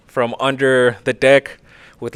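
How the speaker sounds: normal talking voice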